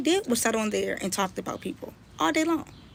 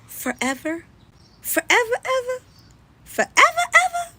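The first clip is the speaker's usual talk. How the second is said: rising higher and louder each forever; mocking incredulity, much higher and louder; incredulous climb; 13 semitones higher, 7 dB louder than usual. Real, not acted. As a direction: stretch the disbelief up to a squeal. Mocking exaggerated disbelief.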